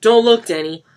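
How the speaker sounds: high, very loud warning; crying out. A narrator's reading. Mark warning Denny away, shouting. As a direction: stop the boy. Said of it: "Mark cried".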